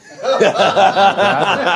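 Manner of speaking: loud burst of real laughter. Real, not acted. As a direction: crack up at the punchline. Laughing at the story.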